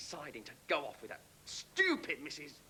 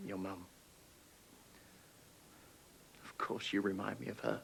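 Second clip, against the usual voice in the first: remorseful apology; 8 semitones lower, 5 dB quieter than usual. Acted. Father apologizes, subdued.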